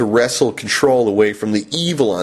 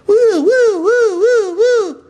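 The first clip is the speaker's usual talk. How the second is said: siren imitation; 4 dB louder, much slower than usual. Imagined police siren.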